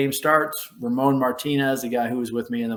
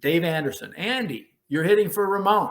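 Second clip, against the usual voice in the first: mock yell; 6 semitones higher than usual. Bench coach to a pinch hitter, half-voiced yell. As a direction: imitate a coach hollering a name. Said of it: "the bench coach yells down".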